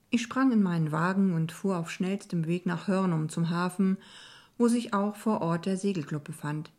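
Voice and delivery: ordinary delivery, nothing marked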